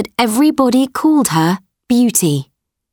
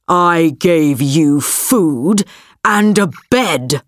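slow, distorted beast roar; deep distorted monster roar; 2 semitones lower, slower than usual. Acted. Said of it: "roared the beast".

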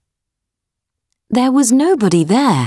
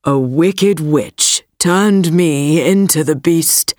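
prince voice; 3 semitones lower than usual. Acted. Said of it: "said the prince".

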